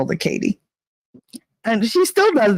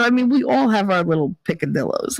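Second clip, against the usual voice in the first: ordinary talk, nothing marked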